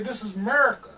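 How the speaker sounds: a touch of Southern drawl on "America"; Southern friend's twang on "This is America", lightly done. Shown as a demonstration. Friend mimics how Southern people say it, in a light Southern accent. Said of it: "They say".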